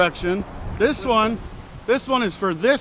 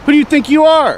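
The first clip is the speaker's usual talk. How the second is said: angry; 7 semitones higher, 6 dB louder than usual. Real, not acted. Heated argument with the officer.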